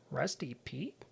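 a soft, muttered aside; quietly puzzled murmur; under his breath. Retold or imitated. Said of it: "under his breath".

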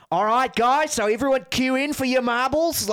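high, loud Australian host; Aussie streamer. Retold or imitated. Streamer inviting everyone to queue for marbles, Australian accent. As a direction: call out to viewers in an Australian accent. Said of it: "this Aussie guy with a beard".

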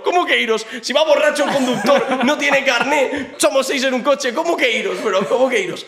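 can't believe they let you go; laughing disbelief. Retold or imitated. Rapper recounting the police letting them go, high laughing disbelief.

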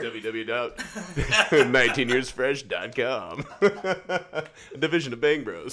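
slow, high, laughing promo read; mock website plug. Podcast host turning the guest's phrase into a website, mock plug. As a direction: draw out a joke web address through laughter.

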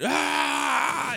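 high-pitched mock scream; imitated yell. Pitcher at the bullpen gate, mock yelling. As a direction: let out a high "aah" like a wild pitcher. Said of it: "and he's yelling".

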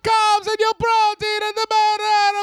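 extremely high mock Italian; shrieking hammed-up Italian, last word stretched; cartoon Italian accent. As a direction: squeal it like a crazed Italian trainer. Tops the Italian impression.

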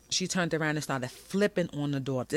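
normal talking voice

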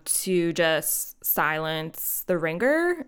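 usual speaking voice

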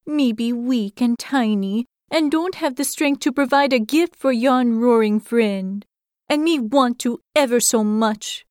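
little hedgehog asking a favor shyly; a tiny creature begging politely; timid hedgehog. Acted. Hedgehog pleads to bear.

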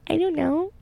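small, high, soft voice; sheepish. Retold or imitated. Scolded student answering her roommate, sheepish and small.

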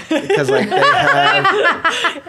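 laughing. Real, not acted. Laughing while describing bar.

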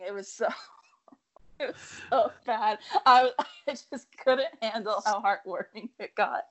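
laughing through the words, a little teary. Real, not acted. Friend laughing while confessing she cried at the film, laughing. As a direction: giggle while admitting how moved you were. Laughing about crying at movie.

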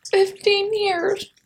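high, very slow and drawn out. Pretend. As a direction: stretch it out up high.

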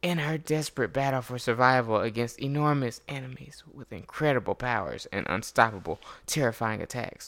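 hushed epic trailer narration, whispered ending; movie trailer voice. Acted. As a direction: tease the danger like a movie trailer. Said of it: "let me get my movie trailer voice".

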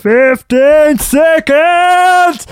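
high, slow coach shout; gym instructor impression. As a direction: bellow it like a trainer. Instructor call in restaurant.

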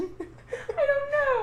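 high, very slow laugh-crying words; stretched out through helpless laughter; giggling so hard the words wobble. Real, not acted. Still laughing at the joke.

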